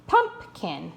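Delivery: "Pumpkin." as PUMP, then kin; correctly divided syllables. Shown as a demonstration. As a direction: sound out "Pumpkin." in two parts, stressed PUMP and kin. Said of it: "divide in between these two consonants".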